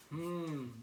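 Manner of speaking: a considering hum; thoughtful hmm. A narrator's reading. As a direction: hum as if thinking it over. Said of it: "Bluey said thoughtfully".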